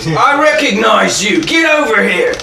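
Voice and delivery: loud, high-pitched Templar yell; accusing shout. Acted. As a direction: shout the accusation, stretching the words. Templar accuses Klaus, calls him over.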